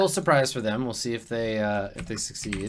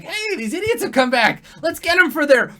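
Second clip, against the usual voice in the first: gleeful high-pitched creature shout; kobold taunt; 13 semitones higher, 9 dB louder than usual. Acted. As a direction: crow at the idiots like a cocky little lizard. GM voices the first kobold.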